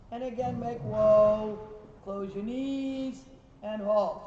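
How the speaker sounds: slow, loud coaching call, stretching the words; drawn-out call. Real, not acted. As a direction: call the halt across the arena, slowly and loudly. Coach calls halt to rider.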